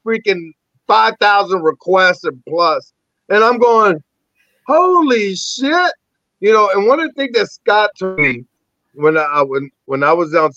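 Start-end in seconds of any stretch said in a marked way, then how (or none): 4.7-5.9 high, slow, drawn-out disbelief; re-enacted amazement. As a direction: stretch each word out, awestruck and high. Retold amazement at friend requests.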